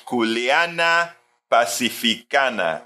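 slow, savored Spanish pronunciation of "Culeana Pacificana"; Spanish intonation. Shown as a demonstration. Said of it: "to intonate that name in Spanish".

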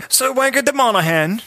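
high, pompous British introduction; mock British accent. Comic body part introduces itself as a knight, mock British. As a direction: announce yourself as a silly British knight. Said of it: "is fucking British now".

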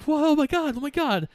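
high-pitched mock alarm; hammed-up, high, horrified exclaiming; mock panic. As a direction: imitate someone panicking at a collapse. Rocky reacts to Mickey's heart attack.

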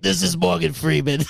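hammy celebrity impression; badly faked famous actor's voice; Morgan Freeman. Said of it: "Do you have any?".

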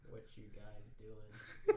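very slow mock Californian drawl; Californian accent. Mimicking callers who say you guys, slow Californian accent. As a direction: stretch it out like a caricature Californian. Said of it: "California or something".

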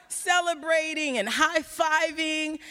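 high, slow and lively; high, buoyant narration; excited. Real, not acted. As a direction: relive the celebration. Retelling the celebration.

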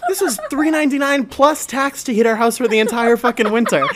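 loud, high, laughing disbelief; hammed-up amazed outburst; incredulous ranting. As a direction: rant it in mock astonishment. Retold naive amazement at cheap heating.

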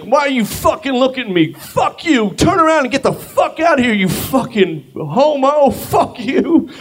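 high shouted imitation of a raging man; mimicking a stranger's high-pitched tirade; angry stranger. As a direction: scream it like the furious guy. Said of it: "the guy's like".

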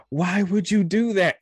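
loud, high, drawn-out mock complaint; mock wail. Girl blaming him after punching him, mock wail. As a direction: wail it slowly in an exaggerated high voice. Imitates the girl's wailing complaint.

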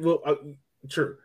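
ordinary talk

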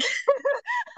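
high shrieking burst of laughter; big laugh. Real, not acted.

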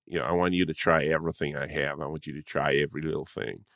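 imitating a laid-back American restaurant owner; lightly put-on American accent, low and easy. Acting the American restaurant owner's pitch.

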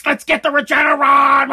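strained, pumped-up shout; hyped-up yell. Acted. Podcaster acting fired up for the drug, straining shout. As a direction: yell it like a war cry. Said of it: "I just blew my voice out doing Regeneron".

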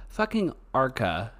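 ordinary talk, nothing marked

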